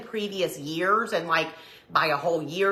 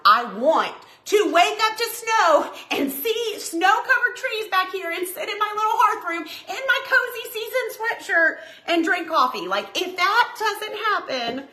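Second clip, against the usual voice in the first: dreamy high wish; 12 semitones higher than usual. Retold or imitated. Retold wish to husband.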